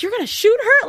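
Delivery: high incredulous yelp; mock-shocked high cry; shocked outburst. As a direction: squeak it out in disbelief. Mock-shocked Dean quote.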